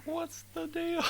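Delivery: very high comic squeak; squeaky voice. Pretend. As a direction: squeak it out for a laugh.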